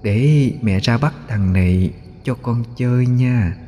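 soft, high, drawn-out ghost voice; ghost mother. A narrator's reading. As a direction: lull the child in an eerie, slow, high voice. Ghost woman soothing her child. (giọng ma nữ dỗ con, cao, khẽ, kéo dài)

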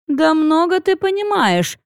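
indignant. A narrator's reading. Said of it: "возмутилась Арилун".

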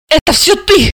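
put-on high, reproachful young girl; high girl's angry reproach; girl shaming. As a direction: scold the cousin. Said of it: "пристыдила его".

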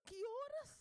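loud, high incredulous questioning; astonished mother. Retold or imitated. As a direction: act out a mother stunned by the accusation. Mother reacts astonished to accusation.

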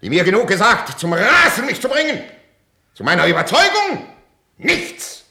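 very loud, high tirade; frenzied fury; raging accusation. Acted. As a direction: shout the blame at them. King accuses his courtiers.

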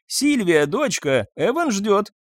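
громко, высоко, зовя наверх; крикнуть дочери через весь дом; зовёт дочь. A narrator's reading. (мать зовёт дочь наверх, громко и высоко)

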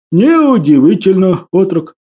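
ordinary delivery, nothing marked

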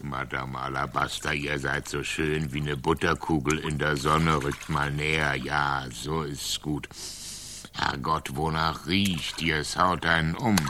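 low, soft, wooing tone; flirting. Acted. Knight woos Madame Alabaster, low and soft.

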